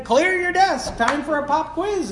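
high-pitched mock teacher announcement; retold teacher. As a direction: imitate a teacher springing a test. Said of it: "and the teacher said".

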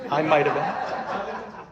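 slow, coy, laughing admission; sheepish laugh. Real, not acted. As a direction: admit it with a guilty chuckle. Repeats confession, laughing.

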